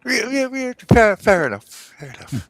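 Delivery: high, stammering gnome voice; flustered gnome. Acted. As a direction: stammer in confusion.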